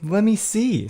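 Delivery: high-pitched kid's demand, imitated; imitated little daughter. Daughter wants a look at the buck, imitated little kid. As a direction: play a curious small child, pitched well up. Voicing his young daughter on phone.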